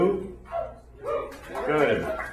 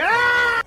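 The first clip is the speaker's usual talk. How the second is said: high, loud holler; drunken night yell; 13 semitones higher, 5 dB louder than usual. Acted. Imitates a drunk yelling at night.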